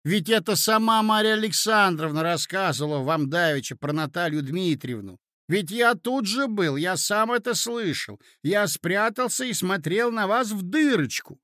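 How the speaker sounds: blurting nephew. Acted. Nephew blurting out that he eavesdropped, insistent. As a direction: protest and give it all away.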